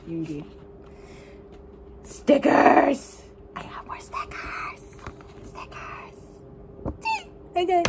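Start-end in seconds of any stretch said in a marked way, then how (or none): 2.0-3.2 excited shout. Real, not acted. Excited reveal of stickers. 3.6-6.2 hushed, giddy whisper; breathless secret glee; gleeful whisper. Real, not acted. Whispers about the stickers.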